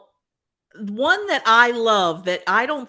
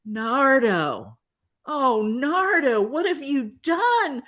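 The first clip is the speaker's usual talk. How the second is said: horrified Louis; 6 semitones higher than usual. Retold or imitated. Louis horrified at her hair.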